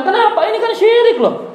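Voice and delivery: laughing shocked outcry; alarmed exclaim. Real, not acted. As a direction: rise high in shocked disbelief. Alarmed about shirk.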